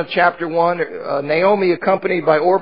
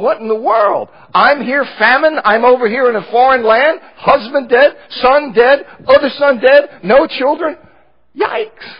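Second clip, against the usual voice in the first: higher, louder, mounting bewildered exclamations; 7 semitones higher, 6 dB louder than usual. Retold or imitated. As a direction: pile up each loss, climbing toward disbelief. Voicing Naomi, overwhelmed by losses.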